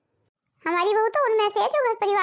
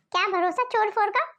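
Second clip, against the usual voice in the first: ordinary delivery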